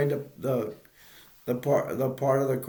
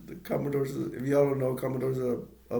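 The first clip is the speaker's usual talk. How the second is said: normal talking voice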